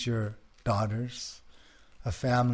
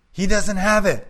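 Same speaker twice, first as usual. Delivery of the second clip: loud, high-pitched yell; raised voice, pitched well up; 9 semitones higher, 10 dB louder than usual. Acted. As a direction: yell it across to the neighbor. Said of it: "the woman yelled".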